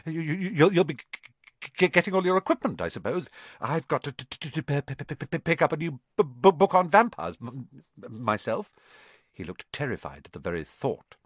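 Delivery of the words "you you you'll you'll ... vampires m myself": halting nervous stutter; stammering. Acted. Quirrell to Harry, anxious stammer. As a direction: trip on every word.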